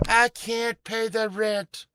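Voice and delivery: high, slow pleading damsel; damsel in distress. Acted. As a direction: plead like a helpless maiden. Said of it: "I just did a play for y'all".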